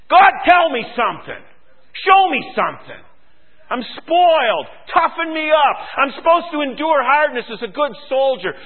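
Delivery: loud, high cry out to God; urgent plea. Acted. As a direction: demand to be toughened up, soldier to commander.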